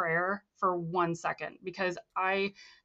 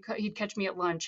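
ordinary delivery

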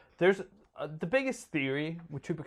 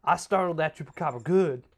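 raised, boastful Southern twang; Southern accent; 4 semitones higher, 6 dB louder than usual. Acted. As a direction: brag like a comic Southern dad. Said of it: "He's becoming more southern".

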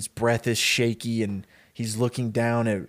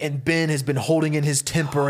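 ordinary talk

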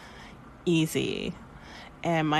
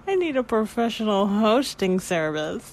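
mock self-important; 4 semitones higher, 5 dB louder than usual.

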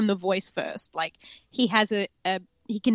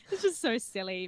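ordinary delivery

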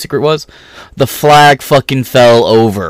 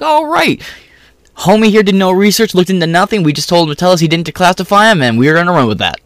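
mocking legal team; 7 semitones higher, faster than usual. Sneering at Newsweek's legal team in a high mock voice. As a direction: squeak it out like a dumb official, then rush the end. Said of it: "They're like".

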